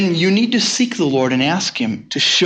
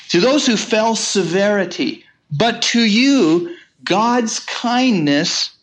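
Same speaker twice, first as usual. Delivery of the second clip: higher, very slow, weighing each phrase; slow emphatic scripture reading; 6 semitones higher, slower than usual. Acted. Preacher reading Paul's warning to believers, slow and weighty. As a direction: draw out the contrast between severity and kindness. Quotes Paul in sermon.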